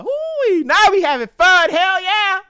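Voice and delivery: high, loud whoop of fun; whooping party hype. Pretend. The spice in the room cheering, high and loud.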